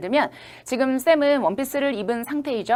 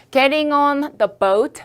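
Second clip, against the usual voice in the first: Getting on the boat, raised and slow; slow model reading; 4 semitones higher, 4 dB louder, much slower than usual. Shown as a demonstration. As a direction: read it out slowly, a little higher. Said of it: "이런 걸 쓸 건데요". (천천히 또박또박 읽는 예문)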